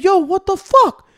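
incredulous yell. Retold or imitated. Yelling at the couple over the fuss, loud disbelief. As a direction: shout it at them, stunned. He yells at them.

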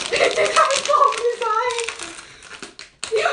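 high, slow, loud groan of disgust; disgusted drawn-out groan. Real, not acted. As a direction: stretch it out, revolted. Rotten egg flavor again.

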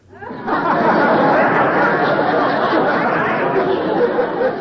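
a long, loud burst of laughter; loud delighted laugh. Real, not acted. [name] laughing hard at Al's walking joke, loud delighted laugh. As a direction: laugh out loud, fully tickled.

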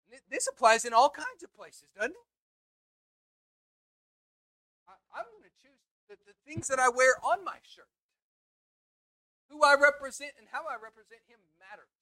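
loud, rising preacher's exhortation; preaching at full force. Acted. Preacher urging the church to serve God, full preaching force.

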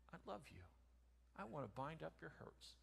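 soft tender. Acted. Tender morning words.

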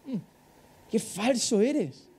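slow, soft scornful murmur; mock scornful aside. Parent unimpressed by a child's fake tears, slow scorn.

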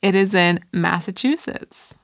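ordinary talk

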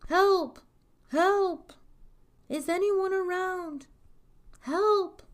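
high, loud cries for help; staged cry of alarm; calling for help. A narrator's reading. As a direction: call out urgently to anyone in earshot. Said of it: "Puss in Boots called out".

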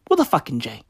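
ordinary talk, nothing marked

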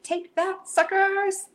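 high, crowing game-night taunt; re-enacted competitive trash talk; gloating alter ego. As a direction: rub the win in, voice shooting up high. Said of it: "there's an alter ego here".

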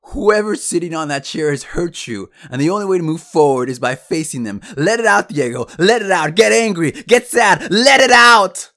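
impassioned shout building to a high scream; shouting coach. Retold or imitated. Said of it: "pointed at the chair and shouted".

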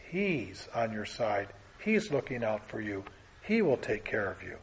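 soft pastoral comfort; gentle reassurance. Real, not acted. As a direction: comfort someone who is hurting.

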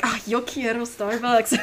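sighing, fed-up line delivery; exasperated. Shown as a demonstration. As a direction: say it like you're tired of it. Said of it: "in a very, like, exasperated way".

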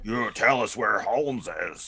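Brit's badly faked American accent, slow and drawn out; mock a British actor attempting American. Co-host copying the show's actor, in a hammed-up bad American accent. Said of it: "excellent impression of a bad American accent".

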